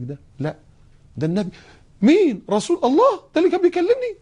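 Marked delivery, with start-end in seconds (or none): 2.0-4.2 very high shocked gasp; shocked realization; shocked disbelief. Retold or imitated. Woman stunned it was the Prophet.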